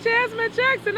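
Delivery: high, loud mock friend reply. Interviewer imitating a friend naming Jasmine, high and loud. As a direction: playfully voice the other person. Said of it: "They were like".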